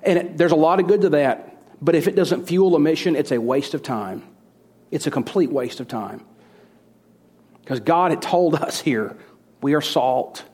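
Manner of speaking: ordinary talk, nothing marked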